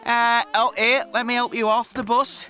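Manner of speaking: ordinary talk, nothing marked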